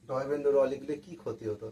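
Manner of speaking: normal talking voice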